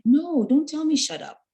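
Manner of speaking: high-pitched, firm retelling of a rebuke; offended retort. Retold or imitated. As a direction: push back firmly, voice up high. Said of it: "And I took offense to it".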